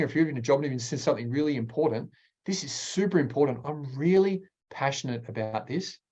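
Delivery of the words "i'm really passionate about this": usual speaking voice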